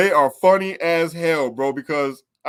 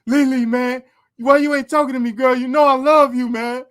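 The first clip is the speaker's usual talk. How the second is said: high, pleading lovesick voice; lovesick inmate; 7 semitones higher, 5 dB louder than usual. Retold or imitated. Inmate pleading with Lily through the window, high lovesick voice. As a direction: beg her like a jealous lover. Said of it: "and he's like".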